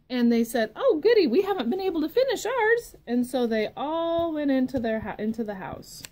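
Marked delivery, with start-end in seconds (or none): 0.8-3.0 high, loud, fast happy squeal; excited little pigs. Acted. Little pigs delighted to share popcorn, high and fast.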